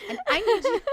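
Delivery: high, loud, emphatic demand; loud insistence. Retold or imitated. Woman lecturing a man at a bar, loud and insistent. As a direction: demand he listen. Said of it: "I was like".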